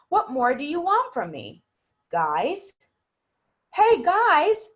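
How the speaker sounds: each question in a new tune, the last one high and loud; varied tones. Shown as a demonstration. Teacher replays student's three differently toned questions. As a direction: give every question its own colour. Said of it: "All three of those were different".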